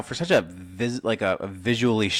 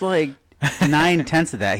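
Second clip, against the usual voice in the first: ordinary delivery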